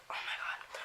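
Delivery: breathless starstruck whisper; awed whisper. Retold or imitated. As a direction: gasp it out under your breath. Starstruck seeing her name signed.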